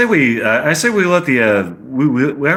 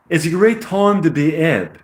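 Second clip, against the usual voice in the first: higher put-on English accent; amateur British accent; 5 semitones higher than usual. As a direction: overdo the amateur British vowels. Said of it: "amateur British accent".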